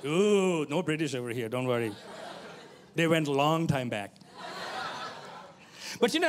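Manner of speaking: usual speaking voice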